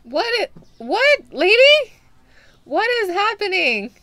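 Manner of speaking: high, loud alarmed questions at the customer; startled yelling. Real, not acted. Shopkeeper to the creepy lady, startled and loud. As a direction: shout in shocked disbelief.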